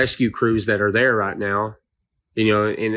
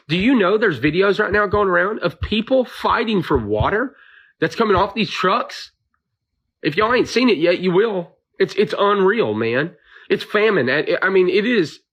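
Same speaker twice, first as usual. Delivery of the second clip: emotional, upset; 7 semitones higher than usual. Real, not acted. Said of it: "I'm getting emotional about this".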